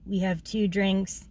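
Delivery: usual speaking voice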